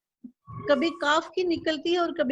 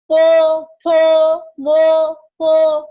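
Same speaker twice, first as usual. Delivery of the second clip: syllables called out loud, higher in pitch; loud, clear drill; 4 semitones higher, 9 dB louder, much slower than usual. Shown as a demonstration. Teacher repeats the row for student, loud and high. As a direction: chant each syllable firmly for repetition. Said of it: "थोड़ा और ऊंचा".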